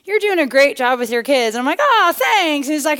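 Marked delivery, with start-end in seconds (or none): 0.0-1.6 imitated neighbor, starting high; complimenting neighbor. Neighbor praising a mom's parenting, lightly put-on voice. 1.8-2.7 high, pleased mock thanks; flattered mom. Mom thanking the neighbor, flattered.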